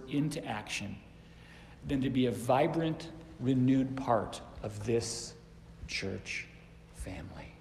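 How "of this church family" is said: whispered. Real, not acted. Sermon climax drops to hush.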